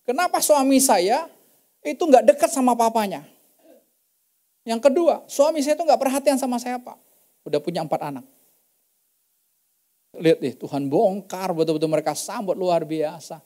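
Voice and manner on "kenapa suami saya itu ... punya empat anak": higher, aggrieved daughter-in-law's voice; the young wife laying out her complaints, pitched up; complaining daughter-in-law. Retold or imitated. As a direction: voice her hurt at her husband. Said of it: "dia tunjukin suaminya lagi".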